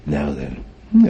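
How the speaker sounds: ordinary talk, nothing marked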